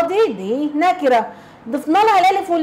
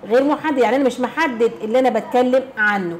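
ordinary talk, nothing marked

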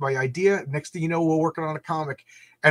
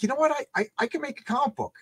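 excited; 8 semitones higher, 3 dB quieter, faster than usual. Retold or imitated. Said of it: "I'm like".

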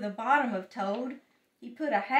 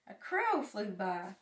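plain reading voice

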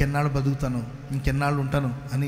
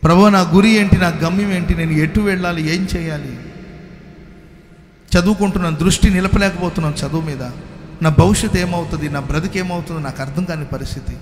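loud, pleading retold prayer, higher; crying out to God; 4 semitones higher, 6 dB louder than usual. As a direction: cry out to the Lord in despair, recalling it. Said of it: "ఎలుగెత్తి ఏడ్చేవాడిని ప్రభు సన్నిధిలో".

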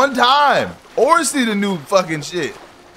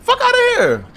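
incredulous yell. Real, not acted. Refusing ransom demand.

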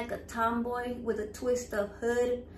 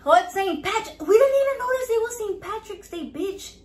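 high-pitched, louder "oh" of sudden realization; real surprise, voice shooting up; surprised; 9 semitones higher, 6 dB louder than usual. Real, not acted. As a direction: jump up in pitch, caught off guard. Just told it's St. Patrick's Day.

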